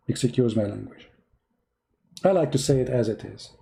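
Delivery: ordinary delivery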